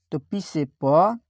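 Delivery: ordinary delivery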